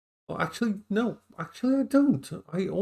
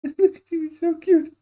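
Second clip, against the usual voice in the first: cooing at dog; 7 dB louder than usual. Real, not acted. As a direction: coo over how cute he is.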